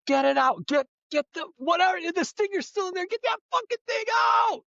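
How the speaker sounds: building to a high frantic scream; mock frantic scream, rising. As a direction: scream for it to come out. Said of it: "she's like".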